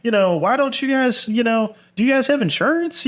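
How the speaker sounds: squeaky high-pitched nosy questioning; mocking high voice. Mocking the nosy guest's questions in high falsetto. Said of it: "he's going around my house being like".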